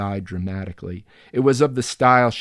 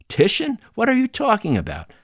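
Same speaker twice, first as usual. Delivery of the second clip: exasperated; 13 semitones higher, 4 dB louder than usual. Acted. Narrator losing patience, retorts.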